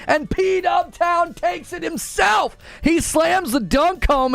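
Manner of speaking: high, slowed sports-caller hype; hype announcer. Real, not acted. Streamer calling out a donation like a game announcer, high and hyped. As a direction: call the donation like a slam dunk.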